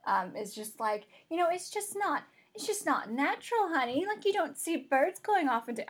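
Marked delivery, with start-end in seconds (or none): 1.3-5.8 high, swooping country grandmother voice; mock country-granny accent. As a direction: put on a folksy country accent, pitched up. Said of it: "went from British to country".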